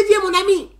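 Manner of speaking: loud, high welcoming call; friendly call. Retold or imitated. Mayor inviting the one-eyed boy up, friendly call. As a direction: beckon the boy over with open arms.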